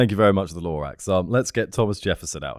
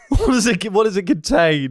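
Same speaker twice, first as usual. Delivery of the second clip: amused disbelief; 11 semitones higher, 3 dB louder than usual. Real, not acted. Pressing for the punchline.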